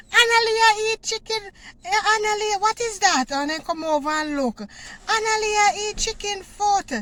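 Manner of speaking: put-on high-pitched child calling out a discovery; excited tattling classmate. Child calls classmates over to see Analia's food, excited tattling. Classmates spot her chicken foot.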